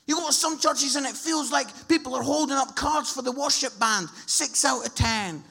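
loud, forceful sermon delivery; impassioned preaching. Real, not acted. Preacher scolding churches that rate worship, impassioned preaching. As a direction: drive each point home at full volume.

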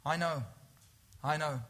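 soft and low, a pause before each name; gentle personal reassurance. Acted. Jesus speaking to each member of the congregation, tender and soft. Repeating Jesus' words softly.

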